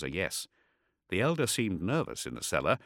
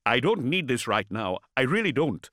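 louder, exasperated refusal; irritated outburst; 7 semitones higher, 6 dB louder than usual. A narrator's reading. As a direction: push him away, fed up. Ishak snaps at astropath.

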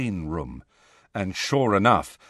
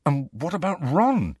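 higher, anxious question; fearful; 6 semitones higher than usual. A narrator's reading. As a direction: ask with a touch of dread. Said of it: "said Harry fearfully".

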